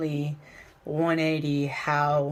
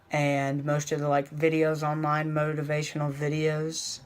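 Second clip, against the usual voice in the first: ordinary talk, nothing marked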